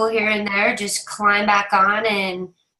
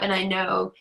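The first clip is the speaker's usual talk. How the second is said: ordinary talk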